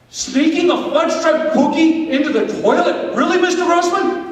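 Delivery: high, incredulous questioning; mock-scandalised sceptic; incredulous objector. Voices a sceptic questioning him.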